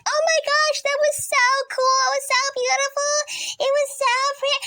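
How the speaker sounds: put-on gushing kids. Kids returning from the eclipse, gushing excitedly. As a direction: gush like kids who just saw something beautiful. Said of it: "I hear a second block run upstairs like".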